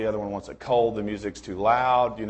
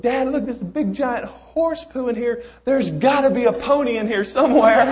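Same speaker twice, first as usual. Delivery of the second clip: put-on high, loud, thrilled boy announcing; squeaky joyful child, laughing at the end; excited kid voice; 12 semitones higher, 8 dB louder than usual. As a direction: deliver the punchline like an eager kid. Optimist kid punchline.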